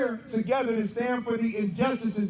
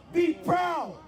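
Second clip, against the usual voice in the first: rousing shout; 7 semitones higher, much slower than usual. Real, not acted. Exhorting the crowd.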